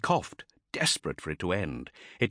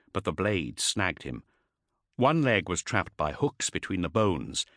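ordinary delivery, nothing marked

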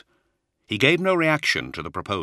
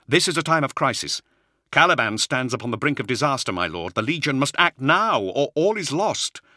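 raised, urgent insistence; 8 semitones higher, 4 dB louder than usual. Acted. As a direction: press hard, the world is ending. Urgent plea continues.

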